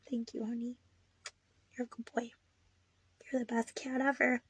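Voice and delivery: tender pet praise. Real, not acted. Thanking her cat for comfort, soft and tender. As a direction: murmur it lovingly to the cat.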